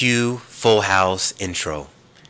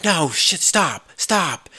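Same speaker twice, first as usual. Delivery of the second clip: frantic stop; 10 semitones higher, much slower than usual. Retold or imitated. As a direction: cut him off in a high panicky snap. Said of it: "she's just like".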